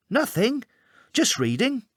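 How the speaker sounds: a kid caught out, playing it cool; child voice. A narrator's reading. Boy hiding under covers answers his mum, high child voice.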